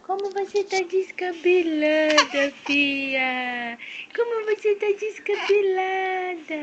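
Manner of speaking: drawn-out teasing singsong; playful slow chant to a little one. Pretend. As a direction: coo it in long, slow vowels.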